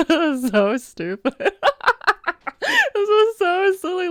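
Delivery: cracking up, pitched high; amused at oneself. Acted. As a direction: laugh helplessly through the words. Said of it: "how funny it is".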